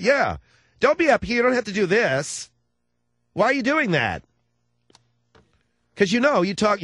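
high, loud lisping camp voice; mock effeminate voice.

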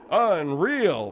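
mock drawn-out exclamation with swooping pitch; imitated exclamation. Host imitating Steve's reaction at the panel, mock amazement. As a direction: imitate his reaction, rising then plunging. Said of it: "you sat there and you went".

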